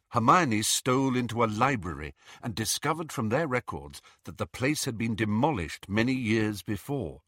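ordinary delivery, nothing marked

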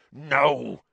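gruff defiance; growled. A narrator's reading. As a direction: growl a refusal. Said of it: "growled Hagrid".

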